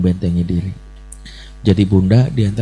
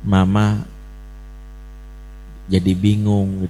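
gentle I message; 2 semitones lower than usual. Retold or imitated. Mother to her crying child, gently voicing her sadness. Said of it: "I message itu rumusnya apa?".

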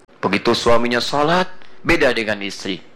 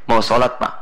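normal talking voice

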